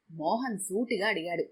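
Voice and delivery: ordinary delivery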